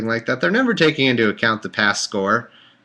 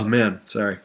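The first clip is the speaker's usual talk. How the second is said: normal talking voice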